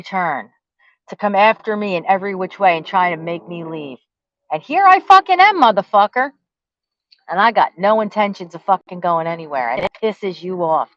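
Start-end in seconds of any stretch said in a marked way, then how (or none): 4.5-6.3 yelled much higher and louder, in defiance; a furious outburst, standing her ground; defiant shout. Real, not acted. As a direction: throw it back at him at full voice. Defiant rant at her enemy.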